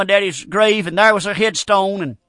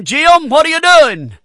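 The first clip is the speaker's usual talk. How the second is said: raised, higher call to a dying man; 7 semitones higher, 7 dB louder than usual. Retold or imitated. Preacher calls to dying Jim, loud and raised. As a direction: call his name loud and high.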